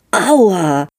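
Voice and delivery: sharp, high, loud ow; a boy pinching his own arm too hard; yelp of pain. A narrator's reading. Fritz pinches himself and yelps, high and loud.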